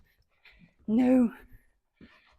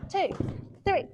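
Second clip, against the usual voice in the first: ordinary talk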